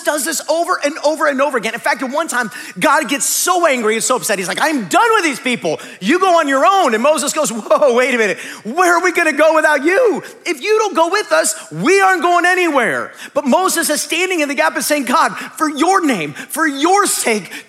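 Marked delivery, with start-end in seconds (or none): 4.6-6.9 fed-up God. Acted. God voiced angry at people. 7.5-13.1 loud, high, half-laughing protest; alarmed plea. Acted. Moses pleading loudly with God not to leave.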